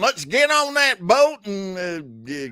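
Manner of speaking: yee-haw cowboy urging everyone aboard; mock cowboy. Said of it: "Does this cowboy bother anyone else?".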